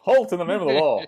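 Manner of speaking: mock cop command. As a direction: bark it like a hammy cop. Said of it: "Did he say".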